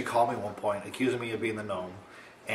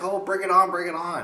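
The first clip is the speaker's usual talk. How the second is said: high mocking dare, bring it on; taunting friend; 8 semitones higher, 5 dB louder than usual. Girl taunting her friend into a prank war, high mock dare. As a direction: taunt him back with a high singsong dare. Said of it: "And she's like".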